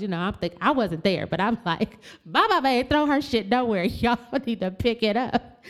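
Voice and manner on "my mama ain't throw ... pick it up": laughing outrage. Retold or imitated. Arguing with staff, laughing.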